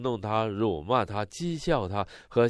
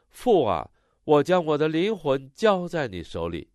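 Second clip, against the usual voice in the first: raised, higher voice calling out in prayer; loud cry; 6 semitones higher, 4 dB louder than usual. Retold or imitated. Interpreter voicing Jesus' cry to the Father, a raised loud cry. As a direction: call it out loud and high, easing at the end. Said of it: "耶稣大声喊着说".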